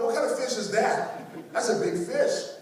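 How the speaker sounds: slowed, high, laughing astonishment; can't believe a fish that big exists; amused high-pitched incredulity; laughing disbelief. Retold or imitated. Reacting to fish swallowing arm.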